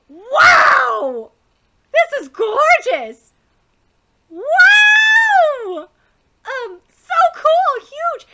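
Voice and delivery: loud, high, drawn-out wows; amazed shouting. Acted. Students seeing the royal classroom shout in amazement. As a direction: gasp out the wonder, slowly. Students see the new castle.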